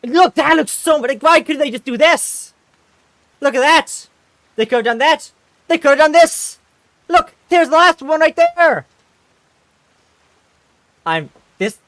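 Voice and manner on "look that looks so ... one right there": high, loud worked-up rant; excited ranting. Real, not acted. Streamer showing fan art to chat, loud and worked up. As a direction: show them, all fired up.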